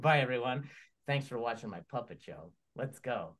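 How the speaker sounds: low, soft puppet voice; Magenta puppet. Acted. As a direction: agree quietly as Magenta. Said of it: "Hi, Magenta".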